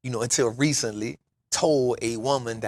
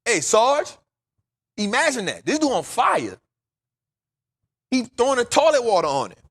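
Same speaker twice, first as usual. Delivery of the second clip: high, loud urgent call to the guard; hollering for Sarge through the cell door; 9 semitones higher, 5 dB louder than usual. Retold or imitated. Calling the sergeant from a burning cell, high and loud. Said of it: "So I'm like".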